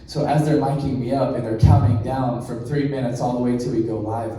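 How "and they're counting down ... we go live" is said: usual speaking voice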